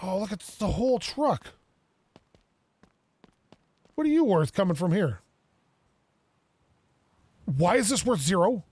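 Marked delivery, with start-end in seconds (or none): none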